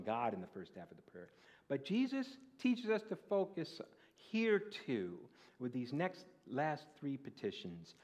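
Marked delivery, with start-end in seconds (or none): 1.7-5.2 higher, slow, emphatic sermon line; preaching at full force. Acted. Preacher to congregation on the prayer, slow high preaching. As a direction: drive the point home.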